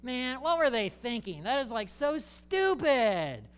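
high, slow mock scorn; mock dismissive onlooker; scoffing judgment. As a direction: sneer at the Israelites. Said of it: "and go".

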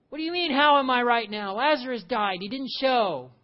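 high, loud, indignant outburst; Martha exasperated. Pretend. Martha answers the question, high and loud in frustration. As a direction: snap back like a grieving sister who's had enough. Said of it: "So how are you right now?".

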